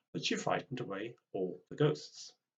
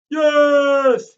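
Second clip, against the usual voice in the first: very high, loud triumphant yell; cheering shout; 19 dB louder than usual. Acted. Football crowd cheering granny's winning goal, loud high yell. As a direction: roar it like a crowd.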